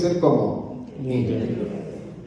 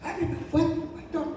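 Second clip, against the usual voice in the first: much higher, teasing put-on voice; imitating the church members; 4 dB quieter than usual. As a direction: mimic the congregation pleading, pitched up high. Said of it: "Algunos hermanos me dicen". (imitando a los hermanos con voz aguda)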